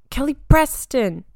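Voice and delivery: real shout of sudden recall, high and loud. Real, not acted. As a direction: shout the name as it finally comes to you. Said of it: "me shouting".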